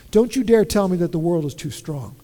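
usual speaking voice

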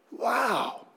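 hushed, quietly impressed; soft wow. Acted. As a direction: breathe out a small wow. Reacting to donation idea.

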